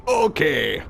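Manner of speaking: mock agreement; gruff okay. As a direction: Torbjorn answering Brigitte. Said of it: "Torbjorn said".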